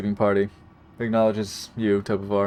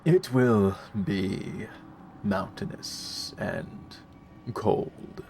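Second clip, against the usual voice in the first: slow ominous whisper; 5 dB quieter, much slower than usual. Acted. DM answers as ominous NPC.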